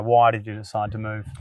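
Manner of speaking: ordinary delivery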